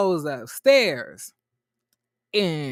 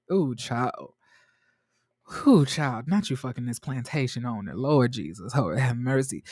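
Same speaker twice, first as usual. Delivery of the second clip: mock scandalized groan, pitched lower; exasperated church-lady reaction; 5 semitones lower than usual. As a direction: shake your head like an old auntie clutching her pearls. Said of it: "And I was like".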